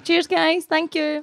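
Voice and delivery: high, sing-out thanks to fans; thank-you shout-out. Pretend. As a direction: lift the pitch for a quick thank-you. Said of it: "give them a wee wave and say thanks for watching".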